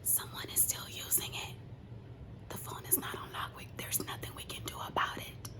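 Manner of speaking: hushed whisper of bad news. Retelling what the clerk said, whispered. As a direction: whisper it like a secret.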